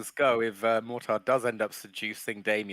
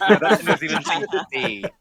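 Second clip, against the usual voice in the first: laughing; 10 semitones higher, 8 dB louder than usual. Real, not acted. Laughs while answering.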